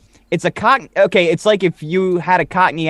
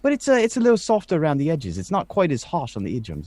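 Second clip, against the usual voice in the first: softened Cockney; 3 semitones higher than usual. Shown as a demonstration. Explaining the grown-up urchin, in gently refined Cockney. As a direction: speak Cockney softened around the edges. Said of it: "you still got the Cockney accent".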